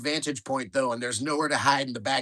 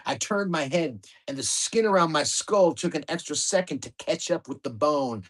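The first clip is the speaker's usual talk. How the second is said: plain reading voice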